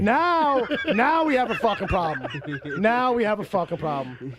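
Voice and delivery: loud, high indignant rant; mock outrage. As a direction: play the offended host, huffing.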